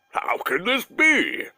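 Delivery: slow high astonished bear; a bear who cannot believe it; shocked bear. Acted. Bear amazed Masha sees him, slow astonished bear. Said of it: "The bear was shocked".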